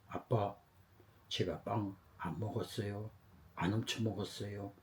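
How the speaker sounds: put-on soft, subdued child's reply; quiet earnest denial. Accused son tells his father the truth, quietly. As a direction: answer father softly and sincerely.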